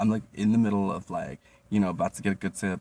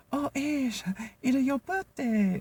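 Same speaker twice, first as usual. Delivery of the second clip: much higher, put-on accented coworker voice, slow; imitating coworker; slower than usual. Coworker greeting him on his birthday, high accented mock voice. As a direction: sing it out as the coworker would. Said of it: "she was like".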